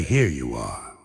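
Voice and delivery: low, soft waiter voice; lightly mocking a formal waiter. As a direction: put on a hushed waiter tone. Waiter voiced in a stand-up bit.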